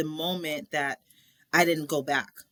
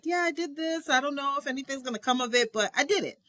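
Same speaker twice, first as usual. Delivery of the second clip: excited telling; 8 semitones higher than usual. Retold or imitated. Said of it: "I'm like".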